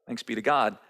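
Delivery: usual speaking voice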